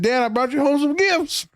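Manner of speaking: high, singing-out mock announcement; eager child to dad. Joking as a child presenting victims.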